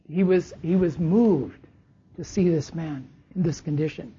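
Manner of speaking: usual speaking voice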